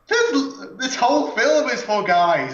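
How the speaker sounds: laughing rant. Real, not acted. Mocking the filmmakers, laughing.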